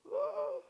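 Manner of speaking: very high, drawn-out "oh"; high-pitched pretend amazement; mock delighted gasp. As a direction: squeal an overjoyed oh in jest. Mock delight at job news.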